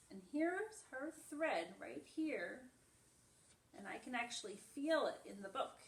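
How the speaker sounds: animated picture-book read-aloud; reading to children. Acted. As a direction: read it brightly to little ones. Reads picture book to class.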